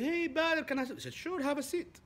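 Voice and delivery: bright invitation to sit; friendly greeting. Retold or imitated.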